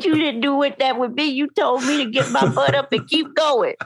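high laughing tease. Real, not acted. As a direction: laugh while teasing. Teasing father, laughing.

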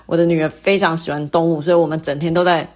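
usual speaking voice

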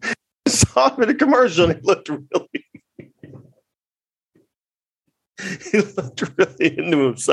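cracking up while quoting; laughing. Real, not acted. Comedian quoting the witness's answer, laughing too hard to finish. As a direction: struggle to get the quote out through laughter. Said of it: "I'm sorry, I'm laughing".

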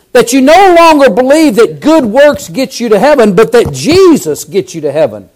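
ordinary talk, nothing marked

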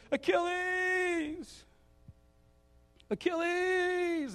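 high, slow drawn-out shout; king shouting a name. Retold or imitated. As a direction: call the champion across the field. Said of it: "the other king goes".

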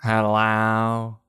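put-on low, slow creaky old-timer hello; mock elderly greeting; old grandparent voice. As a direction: play a doddering grandpa at the door. Senile grandparents at doorstep.

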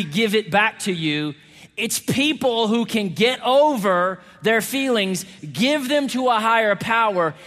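full-force preaching. Real, not acted. Preacher tells church what real love is, forceful preaching. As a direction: build each clause emphatically for the congregation.

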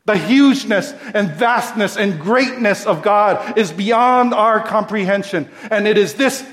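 loud, high, building praise of God; preaching at full force. Acted. Preacher extolling God's greatness to his congregation. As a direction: swell with awe at each God, who.

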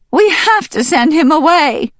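very high, loud hen voice; clucking hen. Acted. Hen insisting to the ducks, very high and loud. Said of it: "the hen clucked".

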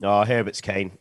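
ordinary talk

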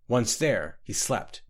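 usual speaking voice

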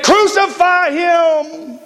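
high, drawn-out chant of a mob; crowd's cry. Acted. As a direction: imitate a crowd calling for blood, long and high. Preacher voices the screaming crowd.

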